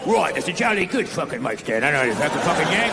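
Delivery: a cheeky English stagehand insulting the Yank; mock British. Angry mic stand guy scolding the American, mock British. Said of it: "the mic stand guy's all fucking pissed off".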